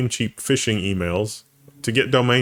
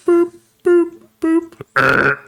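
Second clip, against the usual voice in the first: loud, high sustained boops; imitated alarm beeps; 6 dB louder, much slower than usual. Amazon blocking the cashew purchase, mock alarm beeps. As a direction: hoot each beep loudly on a held note.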